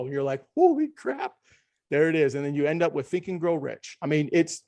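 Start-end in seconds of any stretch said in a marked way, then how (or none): 0.5-1.3 amazed. Retold or imitated. Retold amazed reaction.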